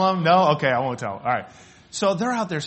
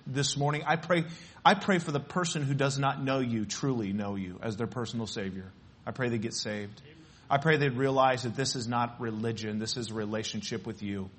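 softer, hushed prayer voice; pulpit prayer; 4 semitones lower, 4 dB quieter than usual. Acted. Pastor prays for the people gathered this morning, softly and earnestly. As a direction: pray quietly over the room.

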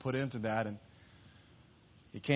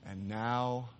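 soft, slow, tender words; tender whisper to boat; 6 dB quieter, slower than usual. Acted. Boy hugging his boat after buying it back, tender. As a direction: speak it lovingly to what you hold.

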